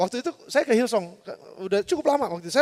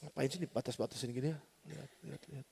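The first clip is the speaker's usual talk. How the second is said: very soft, low aside; sneaky thought under the breath; muttering to himself; 8 semitones lower, 18 dB quieter than usual. Retold or imitated. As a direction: mumble it privately, trailing to almost nothing. Retelling his sneaky thought.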